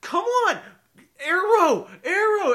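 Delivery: high, loud yelling at the game; frustrated shout. Retold or imitated. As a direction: shout at a broken bow in frustration. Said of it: "and I was like".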